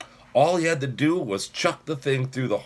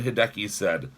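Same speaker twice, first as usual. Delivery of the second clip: plain reading voice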